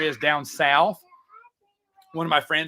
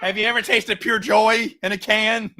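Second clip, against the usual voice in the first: mock ad pitch; 5 semitones higher, 4 dB louder than usual. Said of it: "being facetious".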